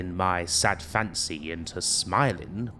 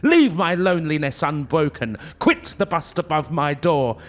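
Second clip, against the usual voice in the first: higher and louder, driving the bird out; furious shriek; 7 semitones higher, 4 dB louder than usual. Acted. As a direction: banish it with fury. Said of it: "I shrieked up starting".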